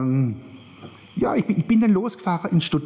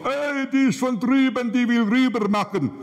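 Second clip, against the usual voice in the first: high, sustained mocking call; suspicious onlookers; 9 semitones higher than usual. Onlookers spotting her with all that luggage, high mock outcry. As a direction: call it out high like a gawking bystander. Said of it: "dann de-, denkt jeder".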